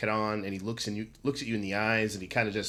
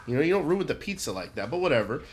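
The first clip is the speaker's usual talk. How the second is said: ordinary delivery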